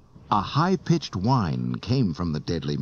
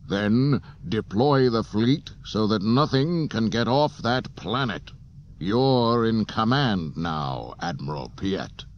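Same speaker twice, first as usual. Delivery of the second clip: Vader orders; 4 semitones higher, 3 dB louder than usual. A narrator's reading. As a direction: give the order with cold authority. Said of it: "Vader ordered".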